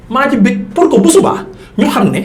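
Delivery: normal talking voice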